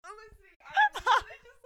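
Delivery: spluttering through laughter; laughing while choking on tea. Real, not acted. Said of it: "are you just choking over there?".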